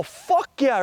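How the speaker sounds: high triumphant cheer; pumped. Retold or imitated. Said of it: "I'm all".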